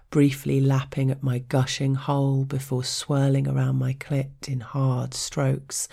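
usual speaking voice

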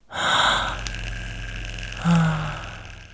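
long, drawn-out breathy exhale; long sigh. Acted. Narrator finishing her story, long sigh. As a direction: let out a slow, voiceless sigh.

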